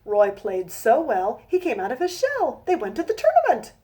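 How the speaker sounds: slow, rhythmic rhyming read-aloud; storybook reading. A narrator's reading. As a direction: read each rhyme out slowly to a child. Reads her rhyming picture book.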